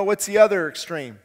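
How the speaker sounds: ordinary talk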